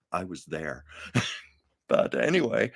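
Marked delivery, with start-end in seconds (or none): 0.1-0.8 low, quiet, flat-out dry; deadpan retort. Retold or imitated. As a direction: say it dead straight, much softer. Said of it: "said so straight and so deadpan".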